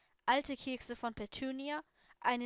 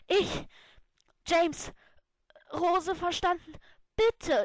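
high, loud gasps between words; desperate, out of breath from running; breathless gasping; 6 semitones higher, 9 dB louder, slower than usual. A narrator's reading. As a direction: blurt it out in panting fragments. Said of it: "japste ich atemlos".

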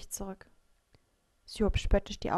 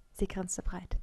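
ordinary delivery

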